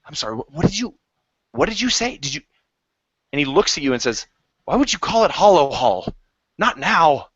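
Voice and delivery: high, sharp, offended elf. Acted. Elf confronting the party over the name, offended. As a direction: bristle at the insult, pitch rising.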